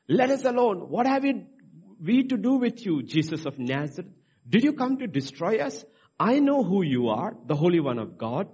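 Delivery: high, loud cry of the demons; hammed-up demon outcry. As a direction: shout it out as the possessed man's demons. Preacher voicing demons' outcry.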